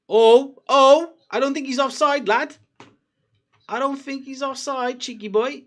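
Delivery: loud, high match-day excitement; excited. Real, not acted. Fan judging a tight offside, high excited shouting. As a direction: call it out high and loud, thrilled.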